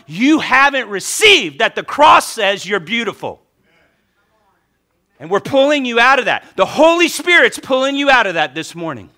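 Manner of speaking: high, loud, insistent exhortation; rousing pulpit delivery; fervent preaching. Real, not acted. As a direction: lift and push each declaration. Preaches with rising force.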